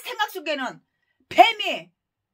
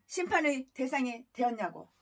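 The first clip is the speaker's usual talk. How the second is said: ordinary delivery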